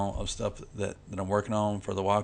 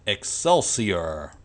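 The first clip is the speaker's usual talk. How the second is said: drawn-out, louder catchphrase with a showman's stretch; Stan Lee impression; 6 dB louder, much slower than usual. Vlogger tries the comic legend's sign-off, mock showman impression. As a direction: stretch it out slowly and loudly in his accent. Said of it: "that wasn't too bad of an impression".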